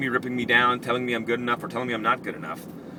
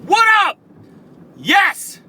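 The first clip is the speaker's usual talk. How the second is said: high, loud whoop; hyped shout; 12 dB louder, much slower than usual. Pretend. Host hyping up his listeners, loud and high. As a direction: whoop it up like a pumped DJ.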